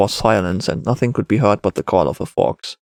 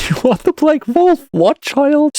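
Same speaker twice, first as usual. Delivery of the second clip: put-on high, louder boy after a laugh; laugh, then scoff in a high kid voice; laughing child; 4 dB louder, slower than usual. A narrator's reading. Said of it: "Now the boy had to laugh".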